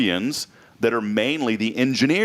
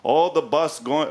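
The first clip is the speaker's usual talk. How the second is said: slow, higher mock-Italian voice; horrible Italian accent; 6 semitones higher, slower than usual. Host retelling the engineer's explanation, bad Italian accent. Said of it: "this accent's horrible".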